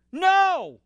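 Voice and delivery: high, sharp protest as Peter; emotional Peter. Acted. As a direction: blurt a desperate high no. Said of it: "in his emotions".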